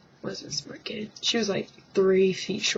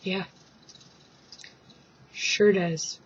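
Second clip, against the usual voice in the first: dry sarcasm; slower than usual. Retold or imitated. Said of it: "I'm like".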